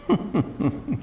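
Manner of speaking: a short rising chuckle at himself; self-mocking chuckle. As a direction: laugh off your own joke lightly. Old man laughs at himself.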